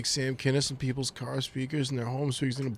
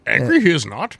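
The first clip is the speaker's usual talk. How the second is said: Yoda voice; 11 dB louder than usual. Pretend. Yoda phrasing, laughing.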